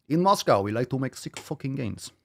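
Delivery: put-on a failed stab at a Russian accent, barely there; mock Russian accent; bad Russian accent. Host fakes a Russian accent for a Moscow member, badly. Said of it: "That wasn't a Russian accent".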